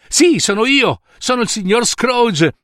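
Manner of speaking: high, delighted self-introduction; eager confirmation. Acted. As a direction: announce yourself with glee. Scrooge si presenta con entusiasmo. (entusiasta, a voce acuta)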